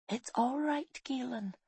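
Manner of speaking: high, very soft, soothing murmur; soothing whisper. A narrator's reading. Woman soothes boy out of a nightmare, whispering. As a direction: hush a sleeper gently, voice lifted and soft. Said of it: "she whispered".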